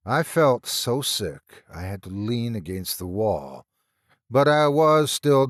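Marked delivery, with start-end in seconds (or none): none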